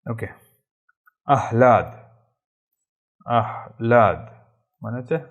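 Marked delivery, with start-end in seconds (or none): 1.3-4.2 আহ্লাদ loud and deliberate, "ah ... laad"; correct pronunciation. Shown as a demonstration. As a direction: sound out আহ্লাদ with the h held before laad. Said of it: "সঠিক উচ্চারণটা আহ্লাদই হবে".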